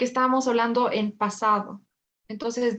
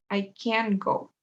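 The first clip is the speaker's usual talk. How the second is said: slow, CAN'T stressed with a silent t; 2 semitones lower, much slower than usual. Shown as a demonstration. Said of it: "acentúo esta palabra". (énfasis en «can't», despacio y sin t)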